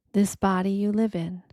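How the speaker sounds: usual speaking voice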